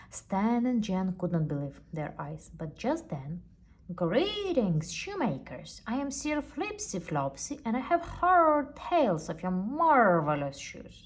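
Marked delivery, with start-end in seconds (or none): 4.0-11.0 high, loud, showy gentleman; grand knight. Acted. Sir Flipsy Flopsy greets the shoemakers, high and loud. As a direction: sweep in like a flamboyant knight.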